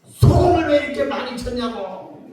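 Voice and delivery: 살짝 화난 투로 따지기; 소금 타박하는 남편 흉내, 약하게 언성 높여. Retold or imitated. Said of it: "갑자기 화를 탁 내요". (아내에게 생선 소금 타박하는 남편, 짜증 섞인 목소리)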